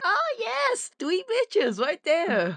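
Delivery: bad German accent; hammy fake German accent. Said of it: "that's a terrible German accent".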